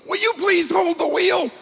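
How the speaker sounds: very high, desperate yell; frantic shout. Retold or imitated. Co-driver pleading as the driver lets go, frantic shout. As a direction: beg him frantically to hold the wheel. Co-driver begs him to steer.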